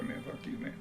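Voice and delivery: a quick whisper under the breath; whispered retort. Retold or imitated. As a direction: mutter it back at him. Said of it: "Kevin, whatever".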